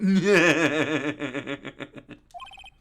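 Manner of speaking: loud burst of long cackling; giving in to laughter. Acted. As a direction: drop the pretence and cackle. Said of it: "who am I kidding?".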